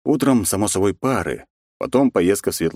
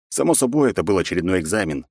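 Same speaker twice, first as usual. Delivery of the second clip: plain reading voice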